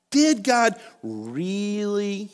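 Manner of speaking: slow, drawn-out sly hiss of doubt; slow mocking serpent. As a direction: let the serpent linger on each word. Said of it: "Let's say that together".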